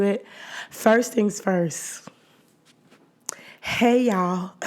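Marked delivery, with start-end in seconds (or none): none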